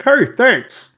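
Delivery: high, loud in-character thanks; bright acted thanks. Acted reply of player's character.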